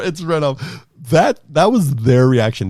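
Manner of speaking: ordinary talk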